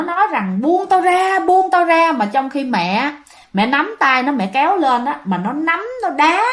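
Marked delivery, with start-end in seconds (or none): 0.6-2.1 higher mock scream of a possessed man; hint of a wild possessed shout; screaming let go. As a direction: retell a frantic yell, pitched up. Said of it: "nó la, nó gào, nó thét".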